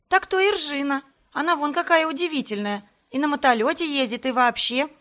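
put-on a girl shouting back while chasing; mildly panting admiring call; breathless shout. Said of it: "запыхавшись, крикнула".

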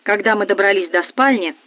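ordinary delivery

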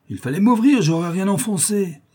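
put-on rowdy drunk protesting; loud self-justification. A narrator's reading. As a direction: throw the blame back at them loudly. Drunk blames them for broken door.

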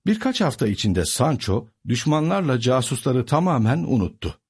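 plain reading voice

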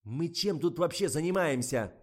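high, very loud scolding; furious director's shouting; stamping tantrum. A narrator's reading. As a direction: berate the actress at full volume. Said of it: "затопал ногами Николай".

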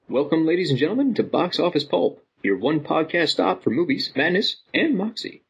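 upbeat podcast host intro; announcer intro. Acted. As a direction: welcome the audience like a showman.

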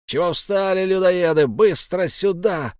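put-on high, rough-tempered old man's shout; bellowed order. A narrator's reading. Old man summoning his idle men, bellowing. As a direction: bellow them over, stretching the last words. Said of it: "гаркнул старик".

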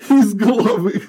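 loud, high, strained disbelief; incredulous echo. Acted. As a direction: repeat it, shocked and loud. Echoing in disbelief.

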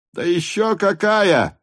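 high, slow shouted reply. A narrator's reading. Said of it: "прокричал Денисов".

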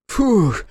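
high, loud puff of breath; winded huff; exhaled "phew". A narrator's reading. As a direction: blow out air after a chase. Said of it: "выдохнул Степ".

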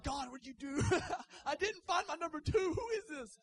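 high, loud mock wail of regret; whiny complaint. Imagined husband regretting his marriage, mock wail.

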